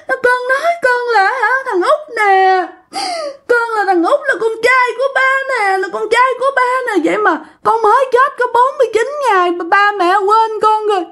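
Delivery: put-on very high, tearful girlish whine; pleading to his parents in a high crying girlish voice; whiny girlish crying. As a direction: sob it out like a pouting little girl. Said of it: "theo cái kiểu như con gái vậy đó nha các bạn, kiểu nhõng nhẽo á".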